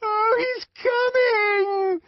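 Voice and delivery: very high, drawn-out wail; screamed cry. A narrator's reading. Woman overcome by the frenzy, screaming high. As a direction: scream it, stretching the last word. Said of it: "screamed Clara Detterding".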